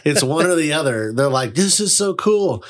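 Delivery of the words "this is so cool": gushing stranger. Retold or imitated. Strangers quoted.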